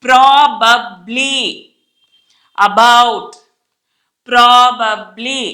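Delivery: PROBABLY and ABOUT slowly, each B firm; teacher's drawn-out word models; every B sounded. Shown as a demonstration. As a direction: break PROBABLY into pro-bab-ly. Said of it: "బి పలుకుతాం".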